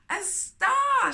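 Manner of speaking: high, loud joyful cry; cried out. A narrator's reading. Little rocket spotting a star, crying out excitedly. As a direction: call it out thrilled at the sight. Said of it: "she cried".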